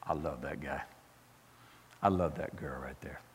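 soft, low whisper of love; tender whisper. Pretend. Pastor voicing God's whispered love for a giver, low and soft. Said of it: "God looks down and says".

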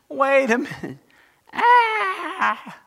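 high, loud alarmed yelp; a sudden shrill mock panic; startled cry. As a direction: cry out as if something just jumped loose. Preacher cries out in mock alarm.

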